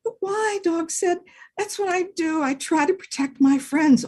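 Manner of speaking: high, eager dog voice; earnest little dog. Acted. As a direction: explain earnestly like a small dog. Dog voice again.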